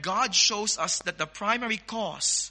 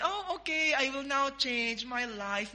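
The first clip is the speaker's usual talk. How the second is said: lightly mimicked easy promise; 5 semitones higher, 4 dB quieter than usual. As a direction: lightly imitate a too-easy promise to reform. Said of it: "you can just say".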